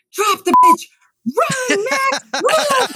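high, loud, urgent command; mother yelling at her son to flee; urgent shout. Retold or imitated. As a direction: shout at him to run. Mom urging son to run.

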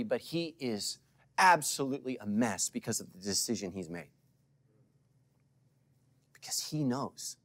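normal talking voice

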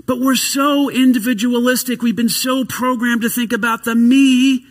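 normal talking voice

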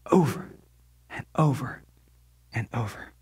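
hushed wonder at endless praise; awed whisper. Real, not acted. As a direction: let it die away over and over. Awed ending about endless praise.

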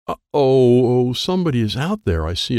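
startled by someone out there. A narrator's reading.

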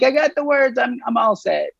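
eager, high and bright; thrilled to finally have it; excited. Retold or imitated. Excited self quoted.

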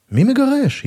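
high-pitched feigned innocence; playing innocent. A narrator's reading. Lover denying he is sending the boyfriend away, playing innocent. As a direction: pitch it way up, as if clueless. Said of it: "התעממתי".

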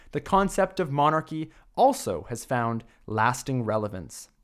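ordinary talk, nothing marked

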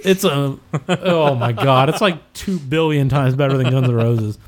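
ordinary talk, nothing marked

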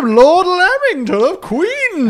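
very high, slow, grand mascot voice; pompous cartoon lord. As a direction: play a cake mascot proudly introducing himself.

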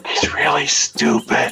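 put-on a girl confiding behind the troll's back; whispered aside. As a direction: whisper it as a secret.

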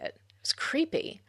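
spooky breathy whisper. Pretend. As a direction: hush it like a ghost story. Whispered aside about creepy villain.

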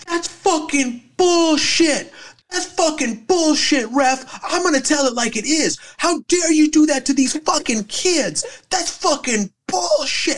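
high-pitched shrieking rant at the ref; raging mom yelling. Retold or imitated. Mom screaming at the ref, mock high-pitched fury. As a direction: mimic a mom losing it on the sideline, voice way up high. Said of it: "she loses her fucking mind".

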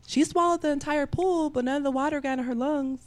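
high, lightly voiced amazed doctor; doctor explaining. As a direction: report it like a surprised doctor. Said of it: "the doctor was like".

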